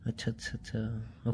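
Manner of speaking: soft, dismissive acknowledgement; sarcastic taunt. Acted. Boyfriend answering her apology, sarcastic. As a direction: brush off the apology quietly and sarcastically. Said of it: "उसमें भी तुम्हें tone मारना है".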